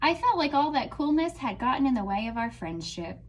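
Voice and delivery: expressive storybook reading; reading to children. Acted. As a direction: read it out like a picture book to a class. Teacher reads picture book aloud.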